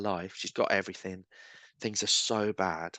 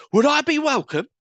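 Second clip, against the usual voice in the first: much higher, louder retold plea; imitating the woman; 9 dB louder than usual. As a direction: voice her timid question, pitched way up.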